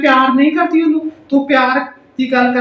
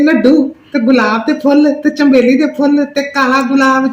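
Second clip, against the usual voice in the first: ordinary talk, nothing marked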